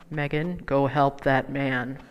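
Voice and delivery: slow, deep divine command; voice of the Holy Spirit. Retold or imitated. Holy Spirit speaking to her.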